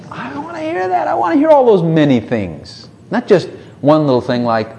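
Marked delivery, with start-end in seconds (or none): 0.1-2.9 high-pitched eager plea; rising, impassioned appeal. Acted. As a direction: beg eagerly to hear it all. Eagerly acting a prayer to God.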